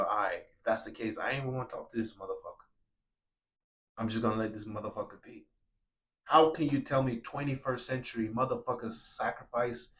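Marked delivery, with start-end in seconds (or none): 0.0-5.5 quick, much softer, throwaway muttering; dismissive brush-off. Retold or imitated. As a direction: wave him off under your breath. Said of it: "and we go".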